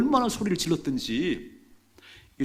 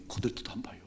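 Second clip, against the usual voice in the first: whispered aside; 2 semitones lower, 8 dB quieter than usual. A narrator's reading. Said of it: "이상해요".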